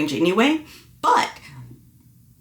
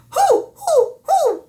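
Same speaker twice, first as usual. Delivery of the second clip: owl hoo; 3 dB louder, much slower than usual. Shown as a demonstration. Vocal coach demonstrates an owl hoo exercise. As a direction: hoot like an owl, high up. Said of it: "called the hoo, like an owl".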